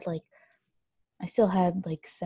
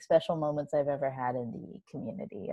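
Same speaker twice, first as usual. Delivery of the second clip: normal talking voice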